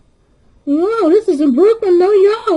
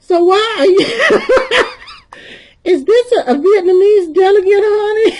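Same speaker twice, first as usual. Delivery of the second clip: loud, cracking up mid-sentence; amused, raised voice breaking into laughs; laughing question; 5 semitones higher, 5 dB louder than usual. Real, not acted. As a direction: ask it through bursts of laughter.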